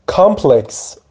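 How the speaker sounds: ordinary delivery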